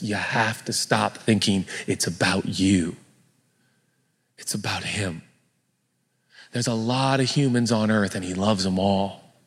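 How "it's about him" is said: whisper. Real, not acted. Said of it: "sermon emphasis".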